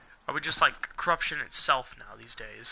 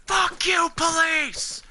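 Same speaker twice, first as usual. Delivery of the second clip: furious shout; 14 semitones higher, 5 dB louder, much slower than usual. Pretend. Angry ranter yells at the police in a furious shout. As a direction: scream it at the top of your lungs, high and loud. Imagined yelling at police station.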